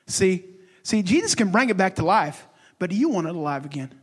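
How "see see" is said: normal talking voice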